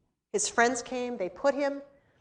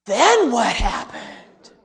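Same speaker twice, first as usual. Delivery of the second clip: slow, louder wondering ask; breathless little girl wanting more; awed child question; 7 dB louder, slower than usual. Retold or imitated. Girl asking in awe.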